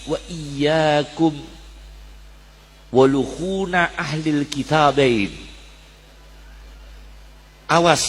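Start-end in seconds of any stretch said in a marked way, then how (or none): 0.1-5.3 slow, deliberate Arabic quotation; slow hadith recitation. Acted. Preacher quotes the Prophet's warning to listeners, slow and deliberate. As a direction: lay out the warning word by word.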